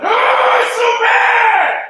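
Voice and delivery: high, loud, slow wail; inner anguished cry. Retold or imitated. What the hurting believer feels inside, a loud slow wail. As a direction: let the hidden despair burst out. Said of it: "Inside, you're going".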